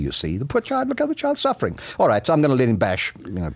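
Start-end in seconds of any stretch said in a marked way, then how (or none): 0.4-1.8 pitying parent, much higher voice; a parent's high, pitying lament over the child; guilty parent pity.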